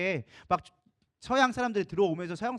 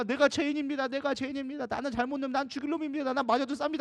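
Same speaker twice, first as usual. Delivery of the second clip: high-pitched, urgent cries of repentance; retold outpouring, pitched high; fervent confession; 7 semitones higher than usual. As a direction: imitate a crowd crying out guilt. Congregation wailing out confessions in pandemonium.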